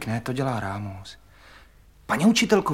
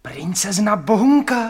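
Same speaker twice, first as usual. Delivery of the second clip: higher, excited exclamation; surprised cry; 5 semitones higher, 4 dB louder than usual. Acted. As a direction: blurt the name out too loudly in surprise. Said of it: "Nekřičte, to nesmí nikdo slyšet". (překvapené, hlasité zvolání)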